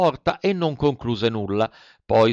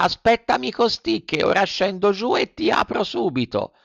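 higher, gentle snail promise; 10 semitones higher than usual. A narrator's reading. (lumaca gentile)

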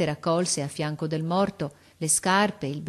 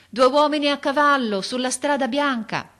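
high, raised lookout's report; calling down; 8 semitones higher, 6 dB louder than usual. A narrator's reading. Boy in the tree reports to officer, calling. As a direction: call it out through cupped hands. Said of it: "facendosi portavoce della mano".

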